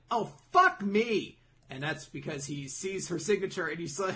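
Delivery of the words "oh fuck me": high, loud, drawn-out dismay; dismayed Petta. Retold or imitated. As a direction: stretch the curse out slowly in horror. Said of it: "then Petta's like".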